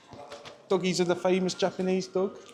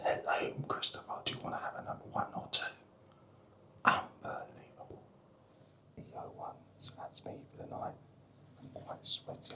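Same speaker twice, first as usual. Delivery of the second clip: hushed whisper so sleepers aren't woken; 12 semitones higher, 6 dB quieter than usual. Real, not acted. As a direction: keep it to a soft breathy whisper. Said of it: "I'm whispering because people might be asleep".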